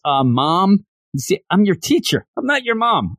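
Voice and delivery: a hint of childlike confusion; Ralph Wiggum. Student mistakes teacher for mom, lightly voiced Ralph Wiggum way. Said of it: "in a very Ralph Wiggum way".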